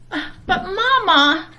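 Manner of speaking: high, whiny cub voice; cub protesting. Acted. Brother protests to Mama, high and whiny. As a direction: whine like a little bear cub. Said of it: "said Brother".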